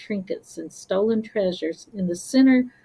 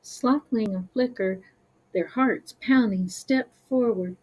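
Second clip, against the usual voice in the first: usual speaking voice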